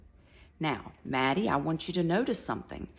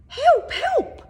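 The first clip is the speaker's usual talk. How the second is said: tiny mouse crying out, much higher and louder; 13 dB louder than usual. Acted. Mouse trapped under the lion's foot, crying high and loud. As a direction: squeal for help like a mouse pinned under a paw. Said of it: "cried the mouse".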